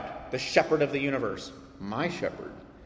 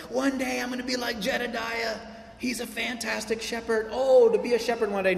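mock dreamy kid; 8 semitones higher than usual. Kids dreaming of being shepherds.

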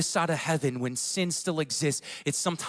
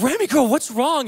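higher, louder worried question; worried dad, acted out; alarmed parent; 8 semitones higher, 5 dB louder than usual. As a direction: retell a parent's urgent check on a child. Retold alarmed parent questioning crying child.

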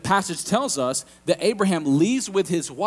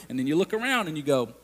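normal talking voice

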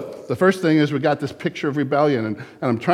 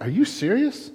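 higher, mock-astonished disbelief; hammed-up incredulous God; 7 semitones higher than usual. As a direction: scoff at the absurdity, pitch up. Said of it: "in the heavens he's going like".

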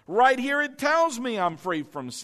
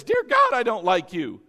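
mock horror at mirror; 4 dB louder than usual. Body looking in the mirror, shrieking in mock horror. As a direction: gasp at your own reflection. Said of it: "looks in the mirror and says".

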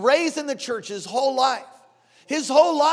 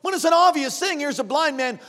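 ordinary talk, nothing marked

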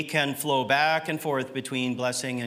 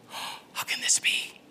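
gasp, then a slow whisper; awed whisper; 4 dB quieter than usual. Acted. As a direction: whisper it in hushed disbelief, drawn out. Acting awe that God is with us.

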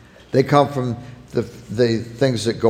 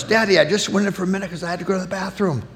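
put-on higher, softer, fast little-boy voice; child's excuse; 5 semitones higher, 5 dB quieter, faster than usual. A son excuses himself to his father, small childlike voice. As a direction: plead like a small kid. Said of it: "he says in his own defense".

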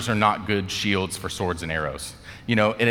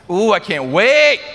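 high, loud, drawn-out "wait"; mock giddy anticipation; 12 semitones higher, 10 dB louder, slower than usual. Someone counting sins until payback, hammed-up giddy excitement. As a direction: squeal it with hammed-up glee. Voicing someone tallying up offenses.

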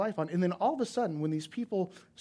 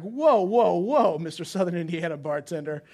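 bartender's slight met with a loud offended whoa; indignant push-back; 2 semitones higher, 9 dB louder than usual. Retold or imitated. Retelling his bristling comeback.

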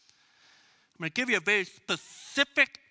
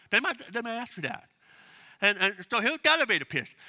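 ordinary talk, nothing marked

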